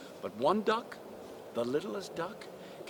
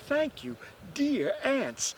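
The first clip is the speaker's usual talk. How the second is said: lightly voiced girl, higher pitch; a higher-pitched, grateful young heroine; 10 semitones higher, slower than usual.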